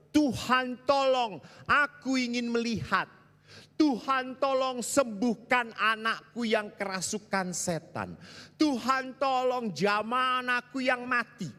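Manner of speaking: high, loud desperate plea; a pleading cry for healing; pleading prayer. Acted. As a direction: cry out to God for help. Voicing pleas to Jesus.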